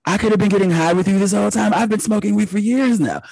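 high, excited pitch to mom; eager confession. Retold or imitated. Son telling his mom, high and eager. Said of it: "I was like".

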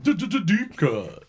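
drawn-out stuttered announcer sting; segment jingle. Pretend. Jingle for a mock sideshow segment.

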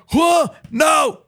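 high, loud yell of disbelief; startled shout. Retold or imitated. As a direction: act out a shocked "stop" to a runaway. Acted startled paramedic, patient fleeing.

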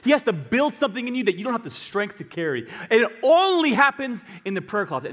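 impassioned, high-pitched exhortation; full-force preaching. Real, not acted. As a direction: press it urgently on the congregation.

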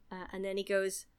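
ordinary delivery

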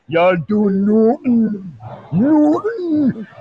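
high, drawn-out mock Irish fighter; Conor McGregor. Claiming a fighter as cousin, hammed-up Irish impression. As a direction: stretch it out in a cocky Irish impression. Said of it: "my cousin's Conor McGregor".

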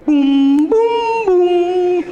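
high, loud sung booms like a radio intro; mock fanfare booms. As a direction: boom out a show's theme. Mimics a radio show's opening fanfare.

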